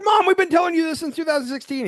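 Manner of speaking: high-pitched exasperated kid imitation. Kids scolding their mom in a skit, high exasperated whine. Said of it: "every time they were like".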